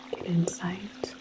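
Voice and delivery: normal talking voice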